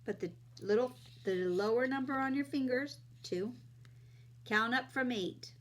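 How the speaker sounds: put-on friendly teacher talking to kids; kids show host. Teaching kids addition on camera.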